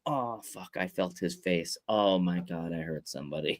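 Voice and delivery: low, quiet retold dread; hushed mock horror. Host retelling being hit by wrestler, hushed horror. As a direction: mutter it as a horrified inner thought.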